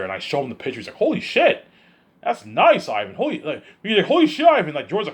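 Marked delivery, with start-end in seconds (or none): 1.0-3.1 mock amazed George. 4.1-4.7 high, loud mock amazement; amazed George.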